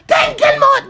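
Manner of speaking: high, loud sudden shout; sudden yell. Real, not acted. Ranting vlogger yells at a rival, high and loud. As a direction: blast it out in outrage.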